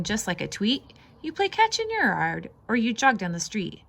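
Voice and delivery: ordinary delivery, nothing marked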